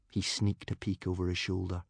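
ordinary delivery

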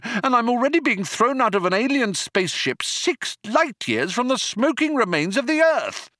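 high-pitched spluttering outrage; spluttering protest. Acted. Arthur protesting as the Vogon grips him, spluttering. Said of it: "He spluttered and gurgled".

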